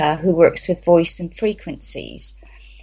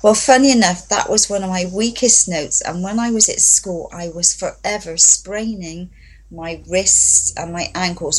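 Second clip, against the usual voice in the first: ordinary talk, nothing marked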